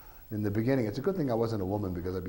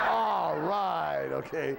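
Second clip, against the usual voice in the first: delighted laugh through the words; 7 semitones higher, 3 dB louder, much slower than usual. Real, not acted. Author amused the review echoed his blurb, laughing. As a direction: chuckle out a pleased okay.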